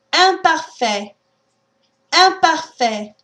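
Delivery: "Imparfait" opening nasal, no M, slow. Shown as a demonstration. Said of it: "Try a few words".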